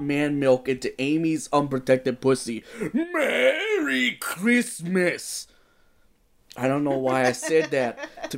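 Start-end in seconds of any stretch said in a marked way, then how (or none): 2.9-5.5 very slow, high, drawn-out cry; ecstatic exclamation. A narrator's reading. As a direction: stretch the greeting out in ecstasy. Santa exclaims at climax.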